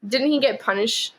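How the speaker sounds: usual speaking voice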